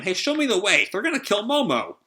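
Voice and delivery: high, urgent acted call; calling back. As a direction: call ahead to a companion in a rush. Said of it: "Luffy calls back to him".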